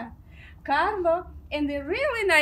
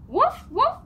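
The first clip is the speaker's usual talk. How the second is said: puppy bark; 6 semitones higher, 5 dB louder than usual. Pretend. Voicing the dog Biscuit.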